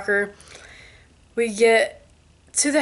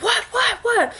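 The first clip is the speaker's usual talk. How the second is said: high, frantic imitation of mom; alarmed mom; 13 semitones higher, slower than usual. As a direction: squeak it out in panic. Mom alarmed by screaming daughter.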